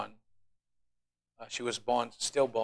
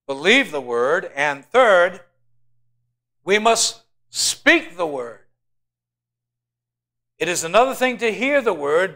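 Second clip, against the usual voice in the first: loud, higher, slowing on each step; preaching at full force; 5 semitones higher, 6 dB louder than usual. Real, not acted. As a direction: hammer the three steps home. Preaching climax, three steps.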